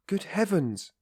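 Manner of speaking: high, startled outcry; shocked cry. A narrator's reading. Mother hearing her son reject the marriage, crying out. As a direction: gasp it out in horror. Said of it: "cried Pulcheria Alexandrovna".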